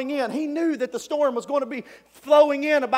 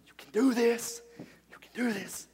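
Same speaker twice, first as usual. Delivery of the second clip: soft murmured encouragement; self pep talk; 7 dB quieter than usual. Acted. Peter quietly psyches himself up on the boat's edge. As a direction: psych yourself up under your breath.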